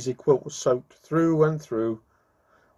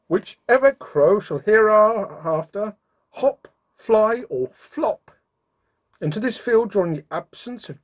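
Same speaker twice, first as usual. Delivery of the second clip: proclamation; 7 semitones higher, 4 dB louder than usual. A narrator's reading. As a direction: proclaim it like an official decree, word by word. Reads out Santa's written notice to crows.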